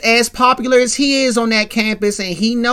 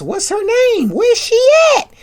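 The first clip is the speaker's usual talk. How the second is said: very high, raised indignant questions; fired-up demand; 5 dB louder than usual. Retold or imitated. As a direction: act out a friend ready to confront someone. Acted friend ready to confront attacker.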